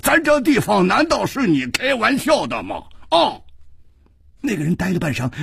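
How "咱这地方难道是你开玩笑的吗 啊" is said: high, indignant challenge; menacing, raised-voice interrogation; bullying demand. A narrator's reading. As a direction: browbeat him, pitched way up. Bully threatens his frightened victim.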